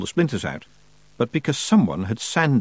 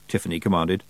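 ordinary delivery

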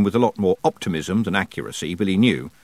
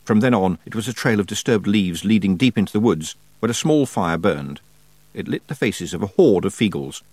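plain reading voice

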